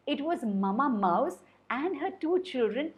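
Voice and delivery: usual speaking voice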